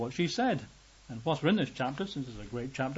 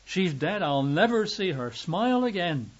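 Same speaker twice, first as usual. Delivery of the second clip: higher and louder, wailing despair; despairing father; 5 semitones higher, 4 dB louder, slower than usual. Pretend. Grieving father imagines his daughter dead, despairing. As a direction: cry out that all hope is lost. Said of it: "Jairus could have said".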